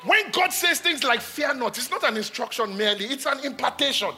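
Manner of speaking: high, loud preaching climax; impassioned preaching. Acted. Preacher urging the congregation, at full force. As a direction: preach it hard, rising with passion.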